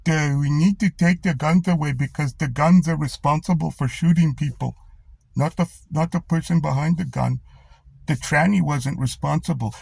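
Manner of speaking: lightly voiced sneering imitation of a clueless politician; mocking dim politician. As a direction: parody him as a dimwit. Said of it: "he's just gonna go".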